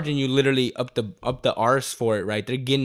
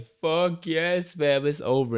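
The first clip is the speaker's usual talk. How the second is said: retold scream; 6 semitones higher, much slower than usual. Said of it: "I screamed".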